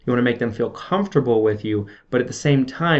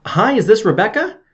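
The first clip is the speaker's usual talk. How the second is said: bright, high-pitched phone greeting; high energy; 8 semitones higher, 4 dB louder than usual. Shown as a demonstration. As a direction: open the sales call with upbeat, rising energy. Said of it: "start the call with high energy".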